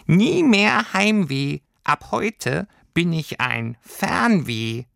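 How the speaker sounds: high, slow, grand creature voice; proud little creature. Acted. Creature proudly declaring itself Wanderlust to Lilli, high and slow. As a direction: proclaim it slowly like a tiny herald. Creature proclaims new identity.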